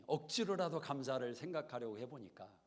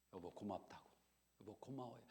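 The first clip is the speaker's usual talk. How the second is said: soft, lower thank you to husband; tender thanks; 4 semitones lower, 11 dB quieter than usual. Retold or imitated. Wife thanking her husband instead of nagging, soft and tender. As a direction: say it gently, like you mean it.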